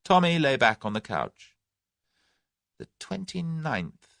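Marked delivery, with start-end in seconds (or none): 2.8-4.2 thoughtful. A narrator's reading. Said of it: "he said thoughtfully".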